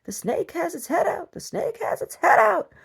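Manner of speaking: frantic high yell that climbs into a shriek; panicked shriek building on the second line; screaming. Retold or imitated. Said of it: "And I'm screaming".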